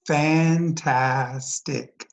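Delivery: "fantastic" very slowly, each syllable stretched; drawn-out syllables. Shown as a demonstration. Teacher showing mouth openings to students, "fantastic" slowly sounded by syllable. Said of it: "How many times did our mouth open".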